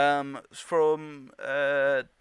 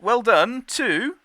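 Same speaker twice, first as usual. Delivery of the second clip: excited; 7 semitones higher, 9 dB louder, slower than usual. Real, not acted. Announcing a winner.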